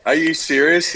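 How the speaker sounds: pitched well up, imitating his own shock; incredulous disbelief. Young player locked out of the room, mock disbelief. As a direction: act stunned at being shut out.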